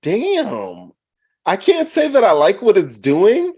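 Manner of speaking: high, loud protest; exasperated shout. Real, not acted. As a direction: yell it defensively. Defensive outburst at co-hosts.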